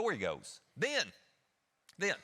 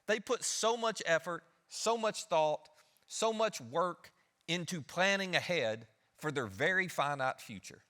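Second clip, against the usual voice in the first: slow emphatic preaching; 2 semitones higher, slower than usual. Acted. Preacher stresses effort and planning.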